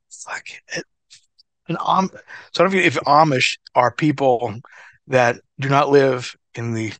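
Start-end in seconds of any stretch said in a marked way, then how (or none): 0.1-0.8 hushed whispered curse. Retold or imitated. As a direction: breathe it out under your breath. Whispered retort in story.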